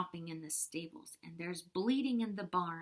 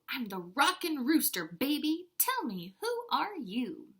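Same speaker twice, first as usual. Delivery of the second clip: a loud, swaggering rooster voice; cocky rooster; 5 semitones higher, 8 dB louder than usual. Acted. Teacher voicing the rooster for the class, loud and cocky. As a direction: strut it like a rockin' rooster. Rooster character speaks in book.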